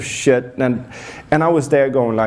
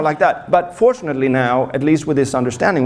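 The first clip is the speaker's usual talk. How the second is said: normal talking voice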